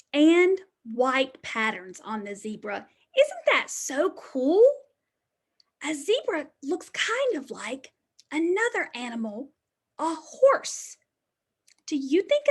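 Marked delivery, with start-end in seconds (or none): none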